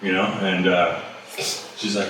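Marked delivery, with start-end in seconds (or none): none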